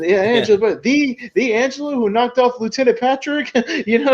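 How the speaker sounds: very high, gushing mob boss imitation; Don Marchese. Mob boss praising Angelo's past killing, high mock awe. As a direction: play an awestruck admirer. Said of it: "He's playing, uh, Don Marchese".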